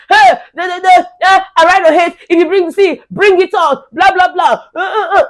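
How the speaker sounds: high-pitched mock ranting; mimicking someone shouting on and on; imitated shouting. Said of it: "He just dey shout".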